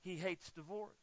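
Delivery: bringing the church down to a soft close; quiet, weighty. Acted. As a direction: let it land softly after the shout. After loud build, drops.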